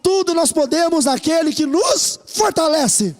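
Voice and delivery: slow, rising declaration building to a shout; full-force pulpit proclamation; preaching. Acted. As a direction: proclaim it like a battle cry.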